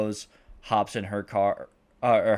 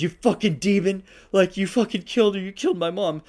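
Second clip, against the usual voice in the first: high, loud, furious accusing voice; acted rage, higher and louder; furious accusation; 10 semitones higher, 7 dB louder than usual. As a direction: scream at her for killing his mom. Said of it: "He's like".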